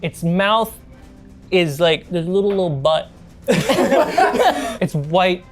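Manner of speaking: ordinary delivery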